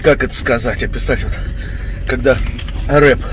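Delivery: normal talking voice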